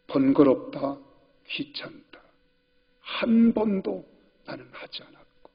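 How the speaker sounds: soft, breathy whispered words; tender whisper. Retold or imitated. Father whispers, reading his diary to his grown son. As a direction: let it fall to a hush.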